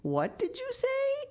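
very high, slow excited question; astonished exclamation. A narrator's reading. Lucy catches Miss Pinchin's words, high astonished exclamation. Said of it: "exclaimed Lucy".

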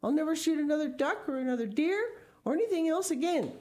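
fast, high-pitched pleading; hurried high vow; desperate cry. A narrator's reading. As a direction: rush out a frantic promise, voice up. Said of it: "cried Mister Gregg".

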